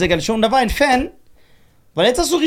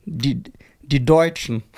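German words in an American accent; 5 semitones lower, much slower than usual. Shown as a demonstration. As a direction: say Deutschen like an American. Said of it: "So amerikanischen Akzent".

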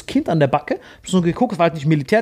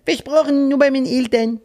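higher voiced little girl promising; child's voice; 7 semitones higher than usual. Girl promises the stranger, high child voice. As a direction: promise sweetly like a kid.

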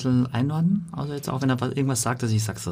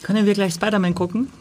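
put-on high little boy voice; child voice; 8 semitones higher than usual. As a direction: play the whining son. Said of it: "Du bist der Sohn".

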